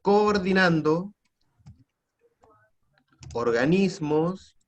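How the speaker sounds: drawn-out, word by word; slow dictation. Real, not acted. Teacher dictating the answer for students to write, slow dictation. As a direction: dictate slowly with long pauses between words.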